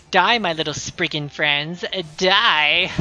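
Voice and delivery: mock evil taunt, building to a shout; mad scientist. Gleefully threatening game enemies.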